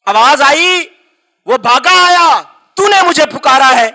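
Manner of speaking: impassioned preacher pushing the story forward; full-force preaching. Acted. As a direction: preach it out to the congregation.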